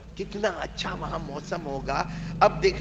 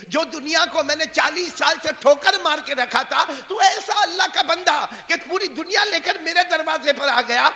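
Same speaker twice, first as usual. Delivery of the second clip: loud, high angry outburst; angry dismissal; 10 semitones higher, 9 dB louder than usual. Acted. Saint sends the pilgrim away, loud and angry. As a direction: drive him away in anger. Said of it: "اتنے ناراض ہوئے".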